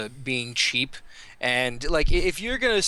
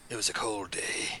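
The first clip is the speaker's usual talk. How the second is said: mock gloomy storyteller; 8 semitones lower, 8 dB quieter than usual. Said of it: "You sound really angry".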